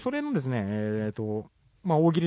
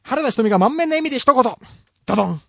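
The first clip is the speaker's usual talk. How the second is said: high, loud game-show announcement; announcer flourish; 9 semitones higher, 6 dB louder than usual. Acted. (司会者風に高らかに読み上げる)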